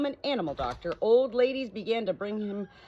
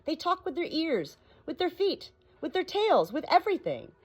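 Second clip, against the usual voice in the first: much higher, lilting parrot voice; 10 semitones higher than usual. Acted. Parrot correcting the doctor in a high voice. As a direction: lift it up high like a bird.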